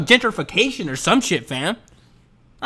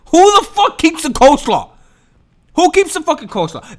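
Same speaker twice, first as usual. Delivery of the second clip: mock outrage; 8 semitones higher, 3 dB louder than usual. Outraged rhetorical question.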